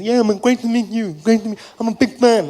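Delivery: keen fan with deaf speech; partially deaf fan. Acted. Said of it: "he was partially deaf".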